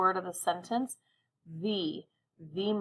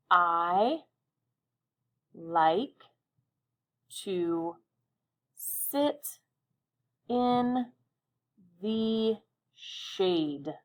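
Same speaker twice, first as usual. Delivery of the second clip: each word alone with long pauses; one word at a time; much slower than usual. Shown as a demonstration. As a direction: pause after every word as you draw. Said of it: "say the sentence while we draw our lines".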